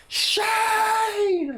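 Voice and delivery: long, very high screamed name; madman scream. Retold or imitated. Dad in the dark woods screaming for his son, high madman yell. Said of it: "Like, like a madman".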